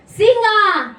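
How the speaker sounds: high, very loud cry; booming proclamation. Real, not acted. Preacher quotes Revelation on Jesus, booming. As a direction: proclaim the title at full voice.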